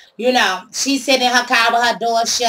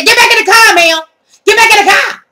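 much higher and louder barked command; police shout; 10 semitones higher, 7 dB louder than usual. Retold or imitated. Officers shouting at the thief trying to leave her car, loud command. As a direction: bark the order like a cop.

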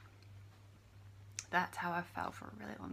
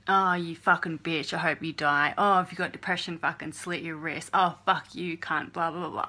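mocking troll comments; 9 dB louder than usual. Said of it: "I don't need to read".

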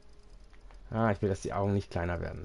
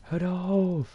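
pleading shout; 10 semitones higher, 6 dB louder than usual. Acted. Third plea high loud.

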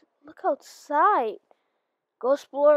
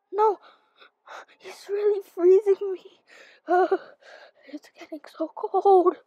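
panicked; 6 semitones higher, 5 dB louder than usual. Acted. Being frozen.

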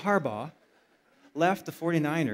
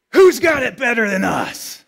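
loud, much higher shouted question to the family; dad's rallying shout; 6 semitones higher, 15 dB louder than usual. Retold or imitated. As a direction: shout it like a coach firing up the breakfast table. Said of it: "would say to his family".

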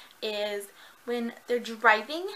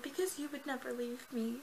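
softer, gentle answer; soft tender reply; 2 semitones higher, 10 dB quieter than usual. Retold or imitated. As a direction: say it quietly, tenderly. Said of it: "he's like".

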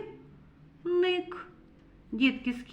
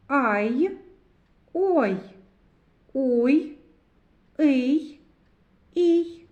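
each syllable read loud and separate, with a pause after each; syllables read aloud; 2 semitones higher, 6 dB louder, much slower than usual. Shown as a demonstration. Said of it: "Прочитаємо склади".

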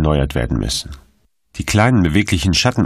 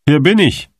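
calling out, much higher; 10 semitones higher, 4 dB louder than usual. Acted. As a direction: call across the square. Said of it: "rief Kettler". (laut rufend, deutlich höher)

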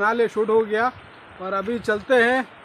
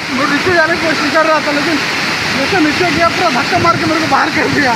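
loud, raised voice over roaring water; yelling over the roar of water; shouting over waterfall; 3 semitones higher, 7 dB louder than usual. Real, not acted. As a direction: shout to be heard over the falls. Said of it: "इतना जोर जोर से बोल रहा".

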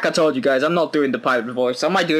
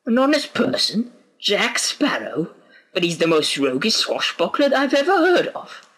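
higher-pitched, disbelieving question; incredulous listener; 9 semitones higher than usual. Acted. Listener doubting the tale of honest Jack Sparrow, incredulous. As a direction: play the astonished listener.

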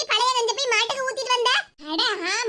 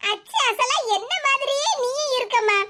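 normal talking voice

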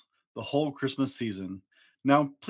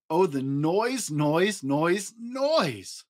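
each noise higher, last one squeaked; rising noise complaint; 4 semitones higher, much slower than usual. Acted. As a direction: build up to a shrill final noise. Building complaint about the noise.

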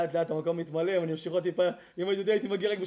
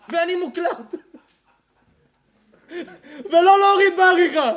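higher, louder mocking rant; fiery preaching with laughter; 10 semitones higher, 15 dB louder than usual. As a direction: tear into the lovesick young man. Preaching at full force.

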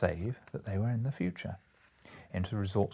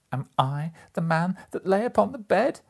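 much higher, louder anguished cry; 11 semitones higher, 7 dB louder than usual. A narrator's reading. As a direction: Scrooge cries out on his knees. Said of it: "he cried upon his knees".

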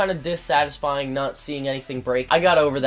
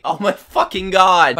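loud, laughing oh my fucking god; laughing exasperation; 5 semitones higher, 9 dB louder than usual. Real, not acted. Gamer dies again, laughing loudly. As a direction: laugh it out in disbelief, louder. Frustrated laugh after dying.

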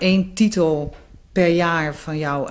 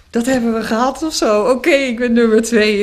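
higher, louder burst of retold relief; retold release; 6 semitones higher, 6 dB louder than usual. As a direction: re-enact the tension suddenly letting go. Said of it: "was het eigenlijk meteen een ontlading". (nagespeelde ontlading, hoog en luid)

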